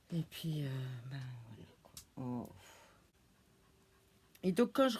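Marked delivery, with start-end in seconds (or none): 0.1-2.5 whispering, choked up; barely able to say it; sad whisper. Real, not acted. Parlant de son chien malade, en chuchotant tristement. About her dying dog.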